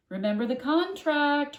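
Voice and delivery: loud high warning, stress on contract; mother shouting. A narrator's reading. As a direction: bellow a reminder up the stairs. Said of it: "her mother shouted".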